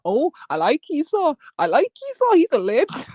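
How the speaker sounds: high, gushing mimicry of Captain; mocking Captain. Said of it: "the more he says it".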